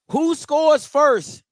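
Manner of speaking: stretched slow and high, like a game-show reveal; drawn-out host hype. Acted. Host building suspense before the first pick. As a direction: draw out each word to build suspense.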